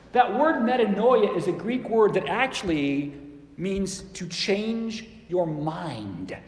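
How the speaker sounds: usual speaking voice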